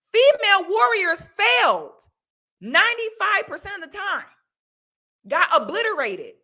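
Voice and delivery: high, loud shout. Real, not acted. Vlogger ranting to viewers, high loud shout. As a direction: blurt it out at the top of the voice.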